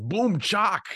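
high, squeaky boom-chock noise; sound effect. Retold or imitated. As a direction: blurt a cartoon impact sound. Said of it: "and he just goes".

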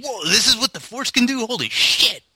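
high astonished voice; mock amazement. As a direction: gasp it out in awe. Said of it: "It's like".

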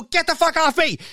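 high, loud frantic shout; panicked yell. Retold or imitated. Squirrel jumps on him, a panicked high yell. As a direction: scream it to shake the squirrel off.

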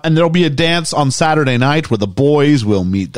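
normal talking voice

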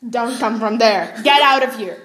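loud, firm get out; loud order. Acted. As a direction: order her out sharply. Ordering sister out.

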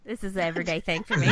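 fast, laughing through the words; giggling at her own joke; cracking up mid-line. Real, not acted. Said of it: "I'm laughing at my own jokes".

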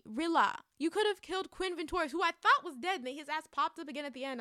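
normal talking voice

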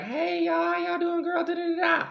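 high camp greeting with a shout; high gushing greeting. Retold or imitated. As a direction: gush it out, girl. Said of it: "let me come in and be like".

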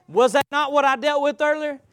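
ordinary talk, nothing marked

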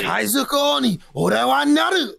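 anime hero shout. Acted. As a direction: bellow it like a shonen hero. Reciting anime line.

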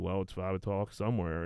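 low, soft, offhand retelling; nonchalant. Retold or imitated. Imitating a witness on the stand, nonchalant. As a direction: imitate an unbothered, casual throwaway reply. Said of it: "so nonchalant".

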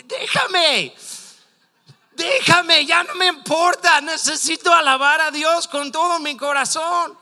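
desperate high-pitched shouting at full force. Acted. Preacher acts a worshipper refusing to be hushed, shouting defiantly. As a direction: cry out past everyone hushing you. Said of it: "aún gritaron más fuerte".

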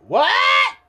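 high, loud startled yelp; mock shocked shout from the maid; shocked what. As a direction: blurt it out in disbelief. Maid shocked at lady's turnaround.

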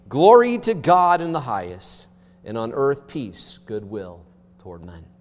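solemn scripture reading. Acted. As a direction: proclaim the nativity text to the congregation.

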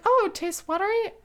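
high-pitched polite question; mock politeness. Barista retells answering a complaining customer, mock high politeness. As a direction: lift the voice into put-on customer-service innocence.

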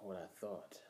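heavy breathy whisper. Acted. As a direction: breathe the words out creepily. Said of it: "Stop breathing like that".

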